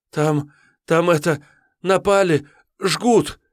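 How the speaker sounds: высокий, громче, задыхаясь; выпалить в панике как дрожащий отрок; испуганный мальчишка. Acted. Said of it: "дрожащий". (испуганный отрок докладывает боярину)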